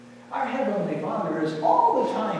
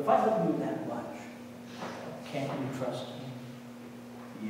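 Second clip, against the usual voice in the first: God's tender plea; 3 semitones lower, 8 dB quieter than usual. Pretend. God asking his frightened child for trust, soft tender plea. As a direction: plead gently, like a loving father.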